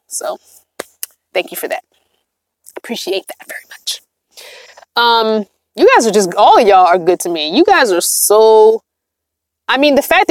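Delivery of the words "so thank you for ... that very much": much softer, fading to a near whisper; heartfelt thanks. Real, not acted. Streamer thanks an anonymous giver, softly grateful. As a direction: thank them quietly and sincerely.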